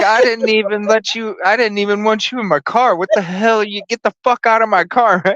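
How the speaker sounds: high, heated mock rant; retold angry outburst. Retelling how he yelled at the hooker in his car. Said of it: "I was like".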